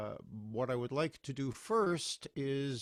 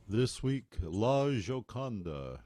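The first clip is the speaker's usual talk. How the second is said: loud billing, title stretched out slowly; old radio announcer; 6 dB louder, slower than usual. Retold or imitated. Host imitating weekly opera broadcasts, grand announcer voice. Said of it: "my best announcer voice".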